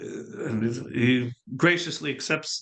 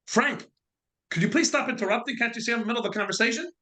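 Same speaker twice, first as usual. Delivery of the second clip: high, fast, mock-irritated snap; annoyed brush-off; 7 semitones higher, faster than usual. Young man showing off to his girl, mock-annoyed at Frank. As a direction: shoo him off like a pest, quick and high.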